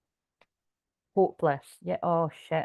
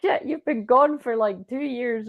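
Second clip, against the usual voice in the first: startled; 6 semitones higher, slower than usual. Retold or imitated.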